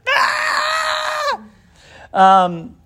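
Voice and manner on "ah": put-on a sudden very high shout like a child crying out; imitating a kid yelling in church; child's wail. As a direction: burst out in a high child's shriek. Child cries out all the more.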